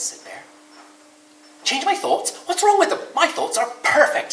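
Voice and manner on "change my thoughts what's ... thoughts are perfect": high, loud, offended rabbit voice; indignant Rabbit. Acted. Rabbit defending his thoughts to Bear, high and indignant. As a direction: bristle with high outrage. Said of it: "said Rabbit".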